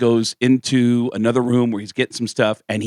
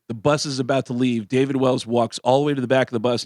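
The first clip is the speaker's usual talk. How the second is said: normal talking voice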